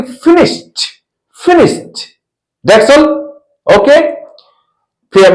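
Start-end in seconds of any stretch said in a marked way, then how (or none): 0.0-2.0 फिनिश्ड as "finisht", high and released T; final -ed as T. Shown as a demonstration. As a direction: end फिनिश्ड on a voiceless T. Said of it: "तो क्या बोलेंगे".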